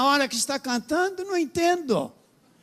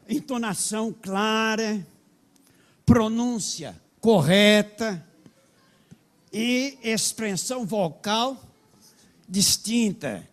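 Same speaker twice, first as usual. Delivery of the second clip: each word drawn out slowly; slow, deliberate; slower than usual. Shown as a demonstration. Pastor stressing the book's phrase slowly to the church. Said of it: "spelling out the principle slowly".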